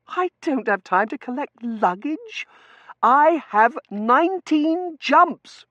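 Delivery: very high, loud and slow; outraged protest. Acted. Overworked Seraphim refusing to collect luggage, outraged protest. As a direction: stretch out each complaint at high pitch.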